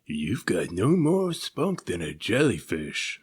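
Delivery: slow, scornful shark voice; slow sneer from a bully shark. Acted. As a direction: look down on him with contempt. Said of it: "sneered the shark".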